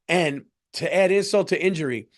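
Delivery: ordinary delivery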